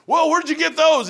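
high, loud startled whoa; surprised son. Retold or imitated. Son seeing his dad's charts on the wall: high startled blurt. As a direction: caught off guard, blurt it out.

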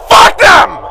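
angry outburst. Acted. Angry inmate curses the detectives, loud. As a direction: snap into a furious yell.

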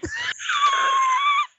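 very high squealed laugh; shrieking laugh. Real, not acted.

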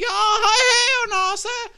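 high, loud, put-on voice of a shocked woman; mock shocked lady. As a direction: squeal it like she just saw the guy. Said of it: "She's like".

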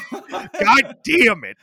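loud, high, drawn-out mock exasperation; exasperated mock yell. Retelling scolding a buddy who set the kitchen on fire, exasperated. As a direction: stretch out the curse, laughing in disbelief.

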